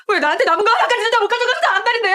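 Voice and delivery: high, loud, near-breaking cry; anguished shout. Acted. Niece screaming at her aunt and uncle in despair.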